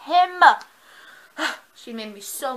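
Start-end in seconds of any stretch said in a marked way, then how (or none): 0.1-0.4 "Him" shot out high and loud; insist, raising your voice; loud insistent him. Retold or imitated. Character insisting in retold scene.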